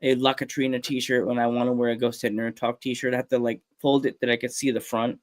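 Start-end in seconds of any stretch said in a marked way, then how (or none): none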